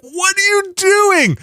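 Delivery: panicked at the dog, voice cracking high; alarmed cry. Retold or imitated. Owner yelling at the bolting dog, high and alarmed. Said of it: "I was like".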